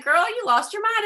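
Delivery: incredulous sponsor. Pretend. Sponsor scoffs at the pushy call, mock incredulous. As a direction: fire back a scoffing, incredulous girl. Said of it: "She'd have said".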